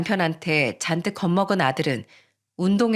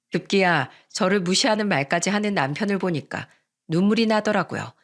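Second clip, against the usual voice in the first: ordinary delivery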